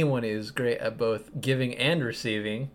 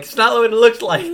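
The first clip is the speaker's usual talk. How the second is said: loud, high, laughing caught-out denial; stammering guilty denials; 10 semitones higher, 13 dB louder than usual. Acted. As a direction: blurt it like a man caught red-handed. Hal caught by Kilowog.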